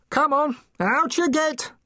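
high-pitched, put-on voice ordering someone up; rousing order. A narrator's reading. Mister Pomfrey awakens the dozing penguin, rousing order. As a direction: chivvy the sleeper out, pitched well up.

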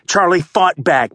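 usual speaking voice

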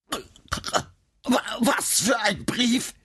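high, strangled, breathless croak; terrified croak. Acted. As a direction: choke out the words while being strangled. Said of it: "brachte Nora schließlich krächzend hervor".

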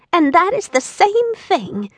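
put-on high, light little old woman voice; a lightly voiced tiny old lady; sweet old woman. A narrator's reading. Old woman explaining to Dorothy, light and high. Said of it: "the little old woman".

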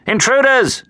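high-pitched alarm call; cry of alarm. A narrator's reading. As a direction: cry out as if spotting trespassers. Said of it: "expected a cry of".

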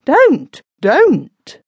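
man cowering in a corner, screaming; screamed. A narrator's reading. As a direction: scream it in panic. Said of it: "stood in a corner and screamed out".

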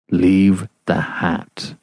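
slow, distinct and muffled; very distinctly. Acted. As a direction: spell out each word slowly through cloth.

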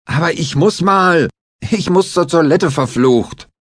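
high, loud cursing plea; desperate protest. A narrator's reading. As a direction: whine and swear at once. Klinger desperate for toilet.

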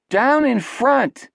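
high, drawn-out yell; annoyed moviegoer's high-pitched shout; heckling shout. A narrator's reading. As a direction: call it out slowly from the audience. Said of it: "someone shouted".

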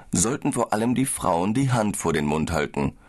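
ordinary delivery

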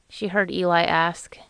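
ordinary delivery

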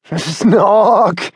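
snorting snore through laughter; Billy copying Henry's snore while laughing; laughing mock snore. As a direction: join in with a giggly snort. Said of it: "Billy joined in".

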